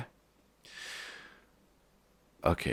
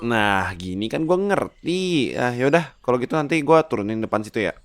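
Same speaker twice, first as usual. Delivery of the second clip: higher, louder brash slang; cocky pig Lipin; 7 semitones higher, 5 dB louder than usual. Acted. Lipin the pig hollering at Marco on the road. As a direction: talk like a cheeky street-smart pig. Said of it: "Lipin replies".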